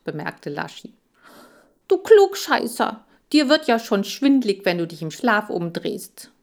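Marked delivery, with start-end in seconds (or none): 1.9-6.3 high, loud indignant outburst that cools at the end; angry retort. Acted. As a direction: snap back at the know-it-all. Hamsters quarrel, narrator snaps back. (Erzähler fährt Laschi empört an, laut und hoch)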